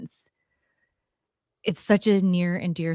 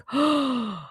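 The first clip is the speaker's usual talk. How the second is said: sharp acted gasp, high; sudden realization gasp; 6 semitones higher than usual. Storyteller reliving the moment she recognized the stranger, acted gasp. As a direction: gasp as it suddenly dawns on you.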